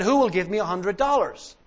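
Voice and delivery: loud, high auction call to the room; auctioneer's call. Retold or imitated. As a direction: sell it to the back row. Auctioneer calling for bids.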